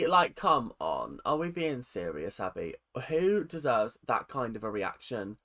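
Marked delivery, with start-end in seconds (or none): none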